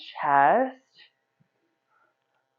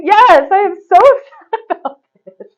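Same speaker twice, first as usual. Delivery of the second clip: higher, louder laughing protest; mock upset; 12 semitones higher, 14 dB louder than usual. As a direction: fake outrage through a laugh. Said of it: "I'm like".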